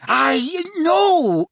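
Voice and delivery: very high, strangled rogue's voice; choking protest. A narrator's reading. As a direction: protest as if being throttled. Mundungus choking in Harry's grip.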